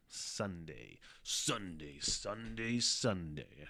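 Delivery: lightly done "Sunday, Sunday, Sunday" announcer echo; a hint of a rally ad booming the day; monster truck ad. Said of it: "I sound like a Monster Trucks guy".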